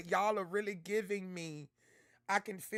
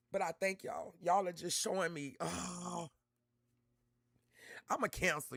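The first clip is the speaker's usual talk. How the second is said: ordinary delivery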